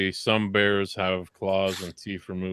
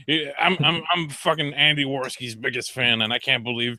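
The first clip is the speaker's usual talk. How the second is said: higher-pitched aggrieved fan voice; mock indignant fan; 6 semitones higher than usual. Speaker voices Andy's fan quitting, mock indignant fan. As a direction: imitate an affronted fan, voice pushed up. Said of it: "one of his fans was like".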